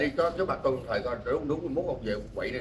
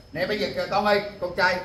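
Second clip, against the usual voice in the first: louder and higher, coaxing the son to answer; 5 semitones higher, 5 dB louder than usual. Real, not acted. Man calls to a child.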